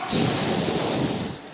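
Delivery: a long, slow unvoiced hiss; snake hiss. Serpent introduces himself with a snake hiss.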